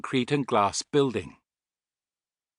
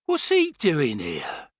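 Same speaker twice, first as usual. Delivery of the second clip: slow, higher-pitched disbelief; stunned gasp; 4 semitones higher, slower than usual. A narrator's reading. Rod seeing the figure on the shore, gasping in astonishment. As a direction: draw it out in astonishment. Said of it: "he gasped a moment later".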